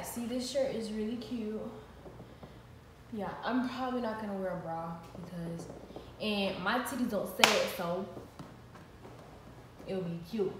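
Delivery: normal talking voice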